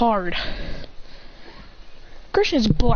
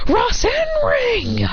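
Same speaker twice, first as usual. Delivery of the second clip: high, drawn-out attack shout; attack call; 13 semitones higher, much slower than usual. Acted. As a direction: stretch out the move's name in a high shout. Fighter calls out his attack.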